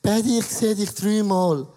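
put-on a drunk seeing double. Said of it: "wenn du betrunken bist, sagst du".

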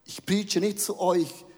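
ordinary talk, nothing marked

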